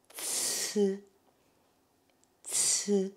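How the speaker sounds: "ts" as a hissed affricate with a buzzed, non-i vowel; drawn-out ts. Shown as a demonstration. Teacher shows how C I is pronounced, "ts" drawn out without an i. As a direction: model "ts" slowly, each alone. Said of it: "Ça se prononce".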